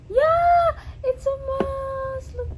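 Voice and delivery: high cheer, stretched out; overjoyed squeal. Real, not acted. Vlogger getting the mouse, overjoyed squeal.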